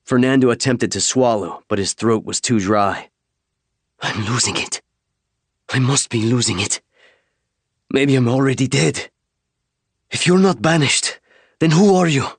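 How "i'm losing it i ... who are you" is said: rising panic. A narrator's reading. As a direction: build from dazed mumble to frantic question. Shaken soldier rambling in panic.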